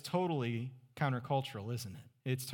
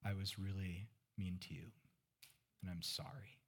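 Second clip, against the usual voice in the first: softer, halting confession; quiet apology; 6 semitones lower, 12 dB quieter than usual. Retold or imitated. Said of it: "she said".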